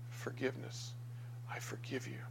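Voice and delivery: hushed, near whisper; gentle forgiveness. Acted. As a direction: breathe it out very softly, with a pause between. Voicing the forgiving blood.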